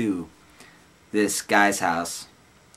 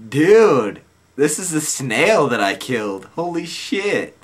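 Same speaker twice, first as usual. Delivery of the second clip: louder, then slow awed; stunned realization; 6 semitones higher, 6 dB louder, slower than usual. Retold or imitated. Stoned narrator finding the crushed snail in the shoebox. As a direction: let it dawn on you.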